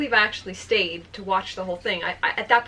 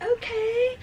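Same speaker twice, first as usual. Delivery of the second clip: very high singsong okay, retold; eager acceptance; 13 semitones higher than usual. Smitten woman accepts invitation to come over, eager. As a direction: chirp it like a smitten girl.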